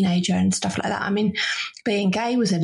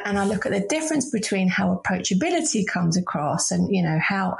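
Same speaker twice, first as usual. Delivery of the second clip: usual speaking voice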